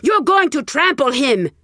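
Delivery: high, loud roar at the crowd. Acted. Rich old woman roaring at the villagers, loud. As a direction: bellow to clear the mob. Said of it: "the rich old woman roared".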